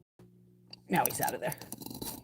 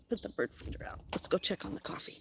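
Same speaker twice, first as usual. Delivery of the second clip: whisper; 7 dB quieter than usual. Real, not acted. Unvoiced aside to herself.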